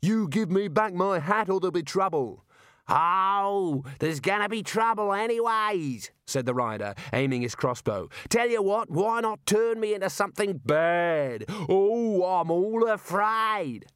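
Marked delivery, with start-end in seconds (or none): 0.0-2.4 high-pitched angry warning; shouted demand from an outraged wizard; indignant threat. Acted. Wizard threatens the hat thief. 2.9-6.1 high, jeering comeback; gleeful menace. Acted. Rider taunts while aiming crossbow. 8.3-13.9 high, drawn-out jeering taunt; mock fear. Rider mocks the wizard's powers.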